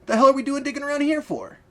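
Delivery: crying out. Acted. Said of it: "cried Jake".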